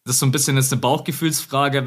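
ordinary talk, nothing marked

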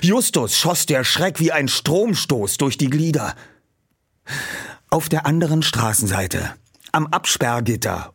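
ordinary delivery, nothing marked

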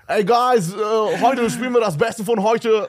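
hoch, laut, amerikanischer Akzent; Radio-DJ mit amerikanischem Akzent. Retold or imitated. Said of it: "immer so einen American Accent". (amerikanischer Akzent)

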